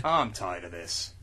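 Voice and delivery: low, quiet, slow brooding hero; a slow, low, gruff action hero; low, soft, slow tough-guy voice. Acted. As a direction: say it weary and dangerous. Low character line.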